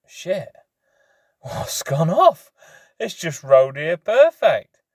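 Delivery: high, slow, incredulous; baffled disbelief. Retold or imitated. Stranded biker replaying his disbelief at the pump, high and slow. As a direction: drag it out in disbelief. Bike won't start, exasperated.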